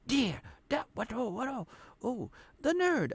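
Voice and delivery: very high-pitched mock brainstorming; halting high bursts landing on an idea; talking to herself. Girl talking to herself hits on the nerd, acted high-pitched excitement. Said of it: "talking to herself".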